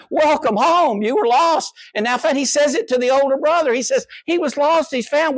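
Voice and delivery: high, ringing cry of welcome; joyful father's welcome. Acted. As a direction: call the lost son home with joy. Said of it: "The prodigal father says to the son".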